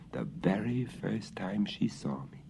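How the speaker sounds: soft unvoiced whisper. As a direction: hush it fondly. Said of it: "growled the dog".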